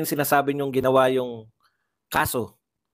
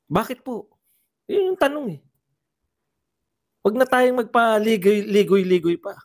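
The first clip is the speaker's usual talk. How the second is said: high, insistent questioning; exasperated demand; 7 semitones higher, 3 dB louder than usual. Real, not acted. Commentator demanding congressmen stop going round in circles, exasperated. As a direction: press them impatiently for a straight answer. Presses congressmen for a straight answer.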